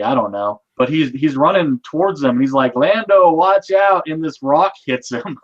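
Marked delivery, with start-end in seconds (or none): 2.8-4.0 calling out a warning. Retold or imitated. Retold warning shout in dream.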